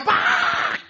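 loud breathy squawk; angry squawk. Acted. Hen protesting the bandages, loud squawk.

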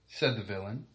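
usual speaking voice

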